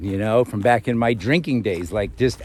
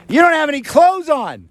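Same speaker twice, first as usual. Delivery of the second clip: high, louder mock yell; imitating the woman; 4 dB louder than usual. Woman in truck scolding the vlogger, high mock yell. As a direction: pitch way up and accuse. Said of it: "She said".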